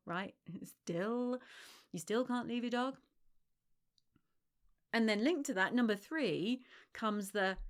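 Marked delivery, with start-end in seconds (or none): 0.6-3.0 "still" punched, rising in disbelief; stress on still. Retold or imitated. Smug friend mocking, stressing still. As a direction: hit "still" hard, incredulous. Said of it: "massive emphasis on the word still".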